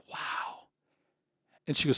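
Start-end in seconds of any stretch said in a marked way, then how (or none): 0.1-0.7 breath-only, hushed amazement; awed whisper. Retold or imitated. Client seeing his monthly retirement income, awed whisper. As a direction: whisper it, as if stunned.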